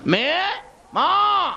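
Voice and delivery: high, rising call; father calling out. Acted. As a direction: call out for the daughter. Father calls to his daughter. (উঁচু গলায় মেয়েকে ডাক)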